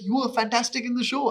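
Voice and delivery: high-pitched imitated praise; awkward compliment. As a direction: mimic polite gushing praise. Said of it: "all these awkward run-ins".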